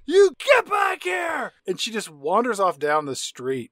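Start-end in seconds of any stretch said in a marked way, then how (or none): 0.1-1.5 high, slow screamed yell; screaming. Retold or imitated. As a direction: scream after her from the car.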